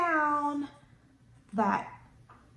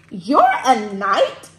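loud, drawn-out question; mild storybook shout; 2 semitones lower, 14 dB louder, much slower than usual. A narrator's reading. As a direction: shout it slowly as a surprised story dragon. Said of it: "shouted Dram".

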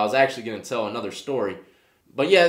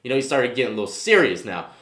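ordinary talk

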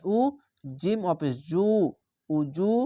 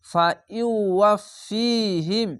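Quranic recitation; 3 semitones higher, much slower than usual. Shown as a demonstration. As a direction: chant the word slowly, as in tilawat. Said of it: "এক আলিফ টেনে পড়বেন".

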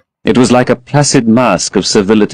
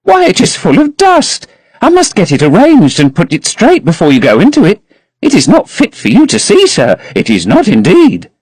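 put-on high, protesting old servant voice; an elderly housekeeper fretting over dust; fussy old housekeeper; 10 semitones higher than usual. A narrator's reading. Voices Mrs Leaf fussing.

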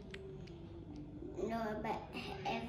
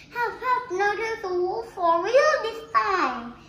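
loud, high pleading cry; acted desperate call to the villagers; shouting for help; 8 semitones higher, 9 dB louder than usual. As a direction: insist the danger is real, shouting. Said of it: "start shouting".

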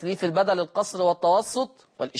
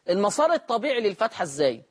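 ordinary delivery

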